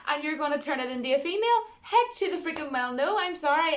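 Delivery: worked-up, half-comic protest; indignant rant. Real, not acted. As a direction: let the outrage climb and burst out. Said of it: "going off in a bit of a rant".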